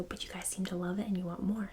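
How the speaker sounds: ordinary talk, nothing marked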